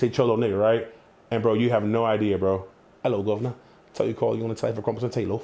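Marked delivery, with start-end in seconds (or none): 3.0-5.4 mock British gent, "governor" style; put-on British accent. Shown as a demonstration. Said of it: "I change accents".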